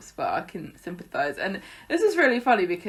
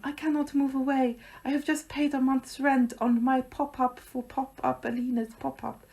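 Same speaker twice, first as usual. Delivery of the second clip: higher, put-on plaintive Alina-style voice; voiced soap character; 6 semitones higher than usual. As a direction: imitate her refusing, pitched up. Voicing Alina refusing to move away.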